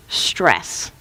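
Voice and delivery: breathy whisper of one ominous word. Acted. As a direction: drop the word like a secret. Dramatic one-word reveal.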